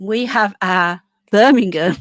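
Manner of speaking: a hint of an American accent on Birmingham. Shown as a demonstration. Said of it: "Do you like my American accent?".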